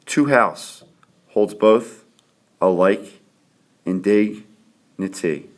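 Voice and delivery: "Two households, both alike in dignity" read in broken chunks, beats marked, slow ending; scanned for meter. Shown as a demonstration. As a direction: tap out each iamb, pausing between beats. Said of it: "I just counted off patterns of unstressed and stressed syllables".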